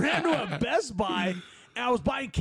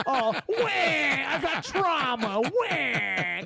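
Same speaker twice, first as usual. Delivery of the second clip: mock crybaby whine; 4 semitones higher, 3 dB louder, much slower than usual. Mocks the kids crying.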